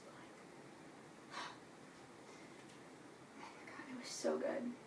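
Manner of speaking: breathless whisper of delight. Real, not acted. Whispering to viewers how good the book was. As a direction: whisper it like a secret you can't hold in.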